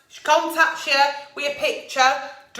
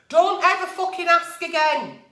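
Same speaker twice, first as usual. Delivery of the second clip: ordinary delivery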